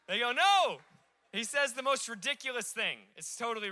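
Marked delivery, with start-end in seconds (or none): 0.3-0.8 a loud, high, flat no; blunt no. Retold or imitated. Fishermen shouting back to Jesus, blunt and loud. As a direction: shout back a grumpy no across the water. Disciples answering from the boat.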